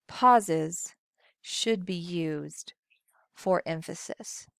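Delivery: "Pauses", then "should be used", then "for emphasis", long gaps between, dropping to hushed. Shown as a demonstration. Teacher demonstrating pauses to students, slow, hushed and broken up. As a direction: break it into three slow, softening pieces. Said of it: "how I'm using pauses to change my pace".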